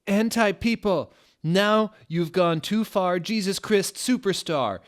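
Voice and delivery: hammed-up shock-rocker yell; screamed. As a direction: belt it from the stage at the crowd. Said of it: "screamed Marlon on the stage".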